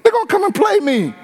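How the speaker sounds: high, playful call-out; high teasing protest; excited high retort. Real, not acted. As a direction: cry out you lying, high and amused.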